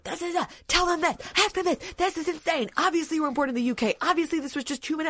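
high-pitched, fast, ranting husband; hammed-up exploding with indignation; imitated rage. Wife imitating husband's outburst over the test, mock rage. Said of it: "he just explodes with rage".

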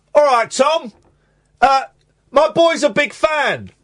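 chirpy dad calling across the cafe; dad's greeting. Retold or imitated. Dad introducing his son to Tom Baker, high mock greeting. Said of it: "my dad went".

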